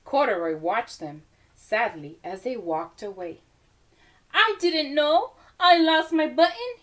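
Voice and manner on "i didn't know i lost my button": higher, louder little bear, surprised; a toy bear finding out something new. Acted. A lightly voiced Corduroy talking to himself, higher and surprised.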